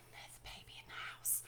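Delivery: fast conspiratorial whisper. Real, not acted. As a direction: lean in and whisper it. Whispered reason for lateness.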